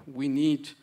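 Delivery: normal talking voice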